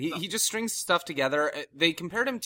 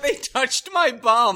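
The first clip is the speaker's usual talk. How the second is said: slow, louder mock yell of they touched my bum, laughing through it; 11 semitones higher, 7 dB louder, much slower than usual. Friend retelling the wrestler's yell: slow laughing mock yell. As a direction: yell it slowly, half laughing. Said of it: "He yelled out".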